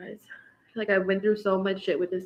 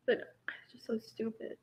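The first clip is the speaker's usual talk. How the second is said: a soft, voiceless whisper; whispered aside; 11 dB quieter than usual. Real, not acted. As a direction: breathe it out under your breath in disgust. Bitter aside while venting.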